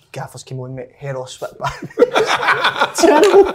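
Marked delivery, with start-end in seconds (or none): 1.8-3.5 laughing; high laugh. Real, not acted. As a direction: laugh then a high, loud terrible. Laughing at story.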